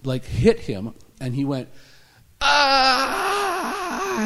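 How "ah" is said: loud, high-pitched wailing cry; sudden mock-sobbing howl; imitated wail. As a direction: let out a long high wail. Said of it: "he started to weep".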